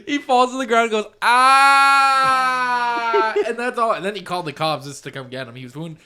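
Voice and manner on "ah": long, high, loud mock wail; hammed-up scream of pain. Man shot in the leg, drawn-out mock scream.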